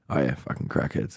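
low, soft, offhand shrug of a reply; imitated dismissal. Bouncer brushing off the bear head story, low and dismissive. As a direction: play someone unimpressed, voice dropped and hushed.

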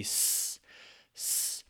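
a soft, voiceless "s" with no vowel after it; just hiss the s, drop the oo; bare s sound. Shown as a demonstration. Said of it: "The oo ... cut off".

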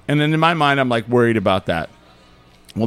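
ordinary delivery

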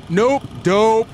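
stubborn refusal. Acted. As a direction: dig in like a brat. McCarthy refuses.

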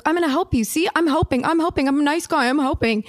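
high, mocking sing-song; mock yelling. To the girls outside the store, mocking the paparazzo with a high sing-song. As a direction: parody the paparazzo playing nice guy. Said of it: "like, yelling".

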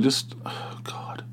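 a despairing muttered oh God; hushed groan of disbelief; exasperated whisper. Retold or imitated. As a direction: whisper it under your breath, fed up. Exasperated at the new waitress.

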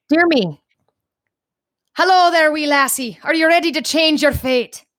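high-pitched, hammed-up Scottish lilt; aggressive Scottish accent. Shown as a demonstration. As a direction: put on an over-the-top Scottish greeting. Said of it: "in an aggressively Scottish accent".

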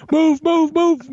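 high, loud shouted commands; frantic yelling. Pretend. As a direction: scream it like a panicked soldier.

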